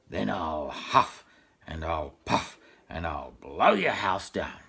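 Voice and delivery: a hint of a growling wolf. Wolf threatening the pig, low and slow. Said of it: "growled in a very loud voice".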